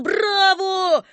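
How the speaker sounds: high, long-held crowd cheer; roared bravo. A narrator's reading. Whole booth roaring approval, high drawn-out yell. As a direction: yell it out and hold it. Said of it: "заорал".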